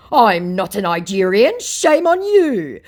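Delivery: shrill, high, outraged protest, stretching shame on you; mock incensed. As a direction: ham up an offended scammer. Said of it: "said my incensed Nigerian".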